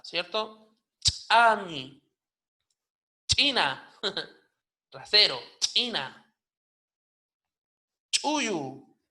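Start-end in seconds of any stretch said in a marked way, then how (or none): 1.0-3.9 "Chami", "China" with popped ejective CH; explosive CH. Shown as a demonstration. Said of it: "C H explosiva". 5.6-9.0 "china", "Chuyu" with popped ejective CH; explosive CH. Shown as a demonstration. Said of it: "C H explosiva".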